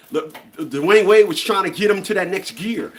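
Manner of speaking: ordinary talk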